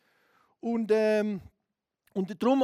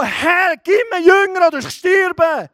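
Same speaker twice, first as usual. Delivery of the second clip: high, loud crying out to God; loud desperate cry, slow on the last plea; shouted prayer; 7 semitones higher, 12 dB louder, slower than usual. Retold or imitated. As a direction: scream it like your life depends on it. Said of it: "Da habe ich zu Gott geschrien".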